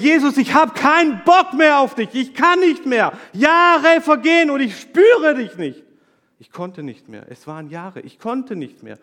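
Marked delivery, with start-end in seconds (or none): 0.0-5.8 furious shout, much higher and louder; angry shout. Retold or imitated. Man recalling raging at Jesus while hitting the steering wheel, furious shout. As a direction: rage at God from the driver's seat. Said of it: "ich habe gesagt".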